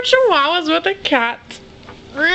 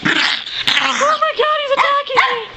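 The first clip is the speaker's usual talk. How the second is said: mock panic; 9 semitones higher than usual. Pet pounces on owner, hammed-up high panic. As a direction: squeal like you're under attack.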